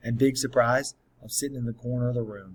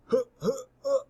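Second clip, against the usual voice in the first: imitated choking gasps; 14 semitones higher than usual. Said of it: "he's making this noise".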